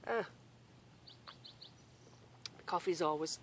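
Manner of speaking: ordinary talk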